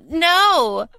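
refusal squeezed out through laughter; laughing no. Retold or imitated. Podcaster quoting the angel's no while laughing. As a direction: say no while still cracking up.

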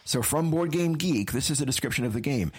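ordinary talk

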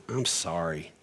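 low, soft, sincere I'm sorry; sincere apology. Shown as a demonstration. Parent apologizing to the child, soft and sincere. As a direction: say it gently and mean it. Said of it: "just going".

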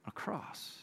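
hushed, breathless whisper; solemn whispered reveal. Real, not acted. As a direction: drop to a whisper for weight. Whispered climax.

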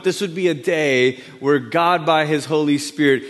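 ordinary delivery

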